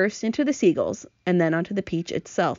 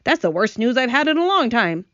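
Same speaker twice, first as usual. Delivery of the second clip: earthworm; 5 semitones higher, 4 dB louder than usual. A narrator's reading. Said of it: "the earthworm said".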